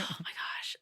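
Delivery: breathy unvoiced whisper. Real, not acted. Caught out by the love triangle, whispering. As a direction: whisper it in embarrassment.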